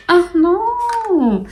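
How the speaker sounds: high, drawn-out wail of dismay; long high-pitched dismay; dismayed cry. Real, not acted. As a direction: cry out as something gets away. Dismayed at something dropping.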